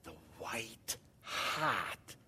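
very slow, intense breathy whisper, low and soft; hushed, passionate pulpit impression; John Piper. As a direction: imitate an intense preacher, drawing out each word. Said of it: "he does these real intense things".

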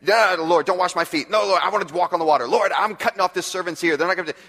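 loud, eager mock Peter blurting out; impulsive Peter. Preacher voices impulsive Peter.

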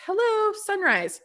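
high, joyful hello to the sunrise; bright greeting. Pretend. Speaker greeting the sunrise after the dark, joyful. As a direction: greet the dawn with delight.

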